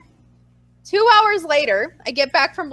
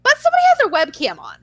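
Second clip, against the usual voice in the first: high, quick mimicked boss; amused reveal; 10 semitones higher, 4 dB louder, faster than usual. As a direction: rush out the catch in a high voice. Acting out boss revealing the catch.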